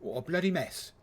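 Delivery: cartoon British exclamation in a put-on British accent; bad British accent. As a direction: toss it off in a jokey fake British accent. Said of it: "That was my bad British accent".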